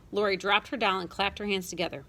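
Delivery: ordinary delivery, nothing marked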